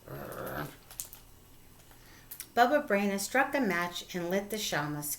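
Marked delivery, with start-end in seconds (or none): 0.1-0.7 bear growl. A narrator's reading.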